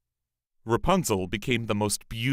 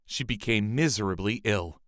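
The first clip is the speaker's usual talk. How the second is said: ordinary delivery, nothing marked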